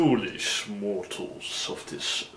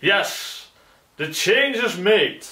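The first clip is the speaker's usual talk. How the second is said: triumphant; 8 semitones higher, 6 dB louder than usual. Acted. Devil triumphs over renaming.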